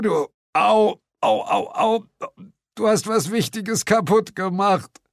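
high, halting words broken by ows; hammed-up injured whimper; groaning in pain. A narrator's reading. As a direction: struggle to speak through pain. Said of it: "ächzte ich".

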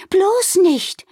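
high, loud protest; alarmed cry. A narrator's reading. Wilma hears of Amalie's wing plan, alarmed. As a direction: cry out to stop a friend. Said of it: "rief Wilma".

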